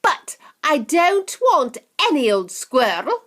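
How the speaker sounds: high loud spoilt shout. Acted. Veruca refusing any old squirrel, high loud shout. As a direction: shout it back, demanding. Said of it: "Veruca shouted".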